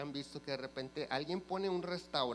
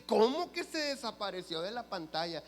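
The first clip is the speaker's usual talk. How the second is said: higher, louder voice of mock disbelief; incredulous question; 6 semitones higher, 5 dB louder than usual. Accountant questions her client about the screen, incredulous. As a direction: imitate an accountant who can't believe it.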